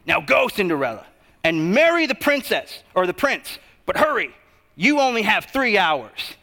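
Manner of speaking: imitating a fairy godmother's rough, forceful order; urgent mock command in a rough voice. Fairy godmother ordering Cinderella to the ball, rough voice. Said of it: "more in a rough voice".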